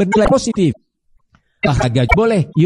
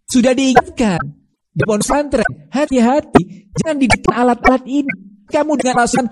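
raised rebuke; 8 semitones higher than usual. Acted. Preacher rebukes parents, higher voice.

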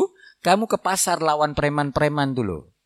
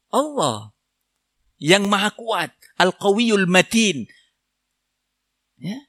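normal talking voice

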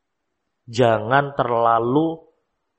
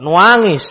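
mock cry; 6 semitones higher, 8 dB louder than usual. Imitating child crying when refused.

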